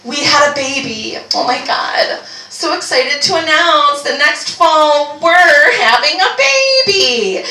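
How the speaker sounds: high, laughing-crying mock gush, rising to a squeal on baby; gushing couple announcement. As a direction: gush like an overjoyed couple on Instagram. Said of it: "for couples to say".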